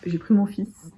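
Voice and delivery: normal talking voice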